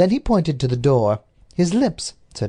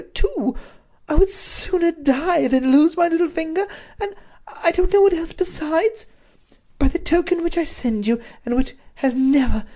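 much higher, pleading woman's voice; distraught duchess; 14 semitones higher than usual. A narrator's reading. The Duchess begging her husband for rescue, much higher and pleading. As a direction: plead desperately as the captive Duchess. The Duchess's letter read aloud.

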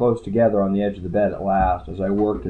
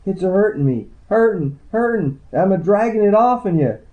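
high, wailing backwoods twang; high-pitched backwoods healer's twang in pain; sharp backwoods twang; 11 semitones higher, 4 dB louder than usual. A narrator's reading. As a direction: cry it out in a sharpened Ozark twang. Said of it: "Her backwoods twang sharpened".

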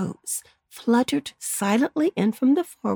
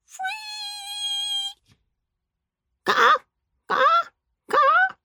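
high croaking caws; croaking crows; much slower than usual. Acted. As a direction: caw shrilly like crows.

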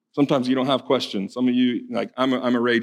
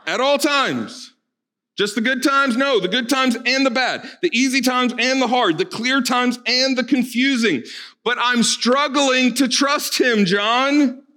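higher pitched, coaxing call to the congregation; interactive call and response; 10 semitones higher, slower than usual. Acted. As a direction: draw the room into answering aloud. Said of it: "Do some interactive real quick".

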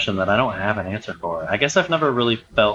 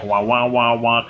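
muffled Charlie Brown teacher. Retold or imitated. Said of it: "like Charlie Brown teacher".

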